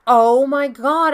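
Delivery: loud, high, drawn-out thrill; re-enacted thrilled outburst; excited exclamation. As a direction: exclaim it in slow, delighted surprise. Retelling her thrilled reply to friend.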